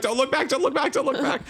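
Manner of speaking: high-pitched panicked chant; retold panic. Player running from the zombie, panicked self-coaching. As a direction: repeat it frantically high, as if fleeing. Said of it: "I'm like".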